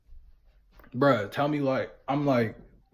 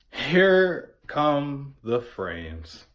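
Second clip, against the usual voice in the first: slow ominous; much slower than usual. Retold or imitated. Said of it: "announcing the high kicking in".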